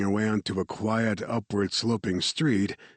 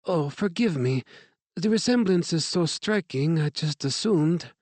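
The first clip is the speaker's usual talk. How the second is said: put-on light French accent, higher woman's voice; a gracious French director's greeting; 9 semitones higher than usual. A narrator's reading. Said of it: "her English marked with a French accent".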